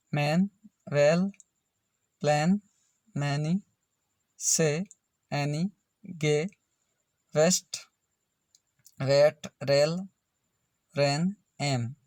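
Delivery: "मैन, वेल, प्लान" and the rest as isolated words, AI sounded as ए; AI sound demo. Shown as a demonstration. Teacher drilling AI words for learners, each word separate and carefully sounded, as in "वेट, रेल". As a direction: read "वेस्ट, वेट, रेल" like a word list, pausing after each. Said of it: "एआई का उच्चारण ए होता है".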